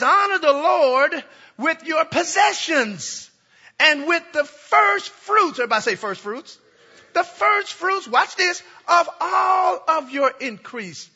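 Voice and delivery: slow, high, loud scripture reading; preaching at full force. Acted. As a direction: proclaim the verse like a herald.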